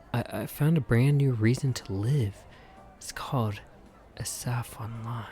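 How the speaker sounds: hushed secret whisper. Real, not acted. Gamer whispering about the game to his audience. Confiding secret new obsession.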